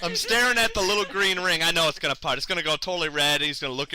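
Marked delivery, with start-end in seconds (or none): none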